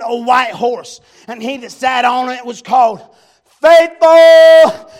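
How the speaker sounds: loud, fervent revival preaching; impassioned sermon delivery; preaching at full force. Real, not acted. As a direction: preach it to the rafters, building to shouts. Preacher reading and preaching Revelation.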